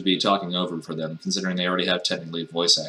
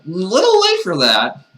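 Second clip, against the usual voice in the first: loud, high, drawn-out retort; mocking reply; 11 semitones higher, 7 dB louder, slower than usual. Player answering Chocolate's apology, mocking. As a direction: stretch out a squeaky comeback slowly.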